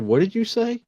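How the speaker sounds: high, startled half-awake ask; jolted awake, pitch jumps up; startled question. Retold or imitated. Father woken by his daughter, asking high and startled. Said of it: "I said".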